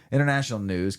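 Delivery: loud, high show-opener call; segment announcer. Acted. Host opening the news segment for listeners, announcer call.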